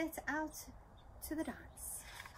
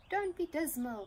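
high, loud cheerful cry; cried out; 7 semitones higher, 7 dB louder than usual. Acted. Fairy calling to gloomy Dinarella, high loud cry. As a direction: call out to cheer her up. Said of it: "cried the Fairy Dactyl".

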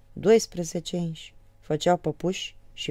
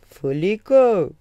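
much louder, slow and thicker; 4 semitones higher, 11 dB louder, much slower than usual. Shown as a demonstration. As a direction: boom the end out slowly. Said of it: "pe urmă tot mai gros". (gros și tare, apăsat)

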